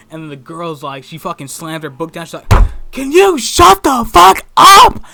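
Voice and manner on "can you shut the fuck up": slow, high, full-volume furious shout; imitating a girl exploding in rage; losing it. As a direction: scream every word, drawn out. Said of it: "she just lost her shit".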